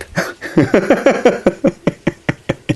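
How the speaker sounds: long, high stuttering laugh; high cackle. Real, not acted. Joker after his thief punchline, long high cackle.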